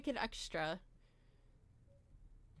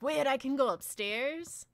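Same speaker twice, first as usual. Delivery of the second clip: rising, louder amazed question; surprised; 5 semitones higher, 8 dB louder than usual. Retold or imitated. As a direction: sound suddenly amazed. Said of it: "I'm like".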